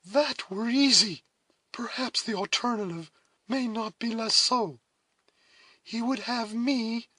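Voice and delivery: high, measured, firm delivery; calmer, firmer. A narrator's reading. Cora weighing the alternative for Alice, calm firmness. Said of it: "with a calmer and firmer voice".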